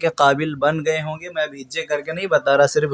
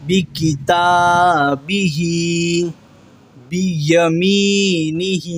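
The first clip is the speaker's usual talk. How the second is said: slow Quranic recitation; 2 semitones higher, much slower than usual. Shown as a demonstration. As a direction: draw each word out slowly, chanting. Teacher recites Quranic words slowly. (آہستہ تجوید کے ساتھ تلاوت)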